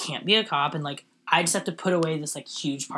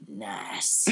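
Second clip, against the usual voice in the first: cop voice; 9 semitones lower, 9 dB quieter than usual. Retold or imitated. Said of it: "They'd be like".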